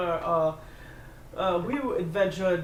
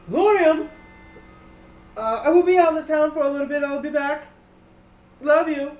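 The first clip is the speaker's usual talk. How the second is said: high, loud call from outside; 10 semitones higher, 10 dB louder than usual. Acted. Finn calling out a goodbye to Gloria, high and loud. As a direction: call across to her before leaving. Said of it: "he goes".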